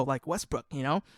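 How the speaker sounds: ordinary talk, nothing marked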